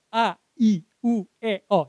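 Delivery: separate, clearly shaped vowels; articulated vowel drill. Shown as a demonstration. Trainer telling trainees to practise at home, distinct vowels. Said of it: "coba Bapak Ibu latih kayak".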